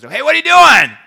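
shouted call down into the ship; captain's yell. Acted. Captain yells to lieutenant down in the hull, loud yell. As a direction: bellow it at full volume, high. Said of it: "the captain yells".

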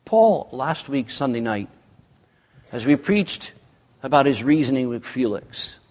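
normal talking voice

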